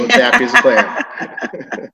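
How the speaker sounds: chuckling through the words; laughing. Real, not acted. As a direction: laugh while you say it. Laughing about wife coloring.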